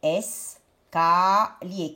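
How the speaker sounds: "Escalier" very slow in three parts, es, ca, lier; slow syllable-by-syllable "Escalier"; split into syllables. Shown as a demonstration. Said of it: "Quelle syllabe on va prendre".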